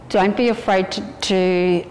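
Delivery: ordinary talk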